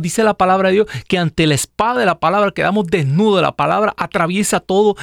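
rising fiery sermon; fervent preaching. Acted. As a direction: preach it hard to the congregation. Preaching at full force.

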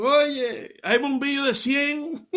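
high, far-off call, laughing through it; God shouting. Acted. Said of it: "así a grito". (Dios gritando desde lo alto, alegre)

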